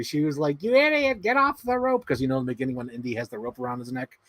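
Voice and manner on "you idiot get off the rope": high-pitched mock heckle at the screen; mom heckling. Imitating mom at the cinema scolding the film's hero, mock heckling. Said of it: "like, heckling the movie".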